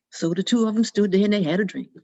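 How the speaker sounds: plain reading voice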